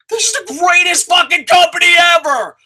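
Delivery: high, loud excited shout; real joyful yell. Real, not acted. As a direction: yell it like a thrilled fan. Excited outburst about wrestling show.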